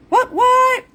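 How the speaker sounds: excited high yelp; playful what what. Real, not acted. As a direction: squeal it like a happy cheer. Playful reaction to praise.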